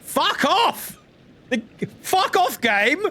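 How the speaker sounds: real annoyed yell, pitched high; frustrated yell at the game. Real, not acted. Yelling at the game.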